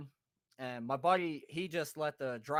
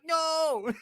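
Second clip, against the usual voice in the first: high, loud mock shout; retold shouted refusal; 13 semitones higher, 10 dB louder than usual. Friends refuse to enter the garage, retold shout.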